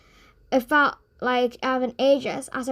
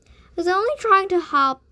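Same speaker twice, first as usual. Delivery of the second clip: sulking; 7 semitones higher, 4 dB louder than usual. Acted. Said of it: "sulked Loon".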